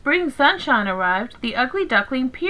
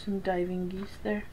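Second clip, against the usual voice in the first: quiet aside; 4 semitones lower, 13 dB quieter than usual. Real, not acted.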